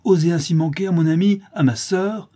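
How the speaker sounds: high, loud indignant outburst; exasperated cry. A narrator's reading. Clara defending her friend from the widow, exasperated cry. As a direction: order her out, outraged. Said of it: "s'écria Clara exaspérée".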